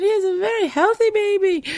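high-pitched, emphatic imitated Jamaican voice; Margaret. Scanner reassuring the mother, high imitated Jamaican voice. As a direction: proclaim it high in a put-on accent. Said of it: "She went".

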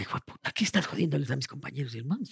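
hissed under the breath; mock menacing whisper; whispered insult. Acting out bully grabbing him.

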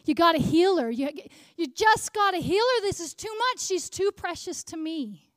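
high, desperate pleading prayer; crying out. Retold or imitated. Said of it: "crying out".

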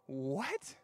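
mock disbelief, rising into a squeak; incredulous. Dad to his panicking son, exaggeratedly incredulous. Dad's baffled reply in story.

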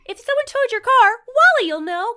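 put-on very high, loud child voice; Reese. Eleven-year-old offering the stranger help, little boy voice. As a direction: pipe up as a helpful little boy. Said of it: "this little eleven-year-old boy".

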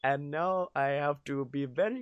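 hushed mock Ugandan accent; Ugandan Knuckles. Scolded guy explaining his mom's complaint, quiet mock Ugandan accent. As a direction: put on a Ugandan accent, subdued after a scolding. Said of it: "it was a guy whose mom just yelled at him".

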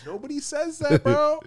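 squeaky high disbelief; high-pitched protest. Real, not acted. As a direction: shoot way up in outraged disbelief. Protesting a crude joke.

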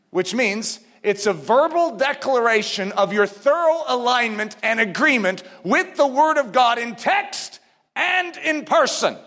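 ordinary talk, nothing marked

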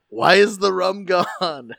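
loud, slow, laughing pirate drawl; Johnny Depp. Retold or imitated. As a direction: boom it out like a drunk pirate. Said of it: "I'm gonna be like Johnny Depp".

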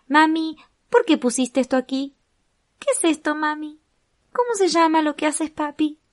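put-on high, curious little kid voice; child voice. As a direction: ask like a curious small child. Voicing children questioning their parents.

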